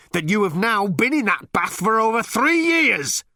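high, loud, furious reminder; hissed rebuke. A narrator's reading. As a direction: build your indignation up high at the captain. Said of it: "hissed number two at the captain".

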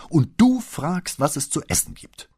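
indignant outburst. Acted. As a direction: throw the question back at him in outrage. Paola railing at absent husband.

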